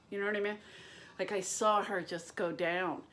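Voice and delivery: ordinary talk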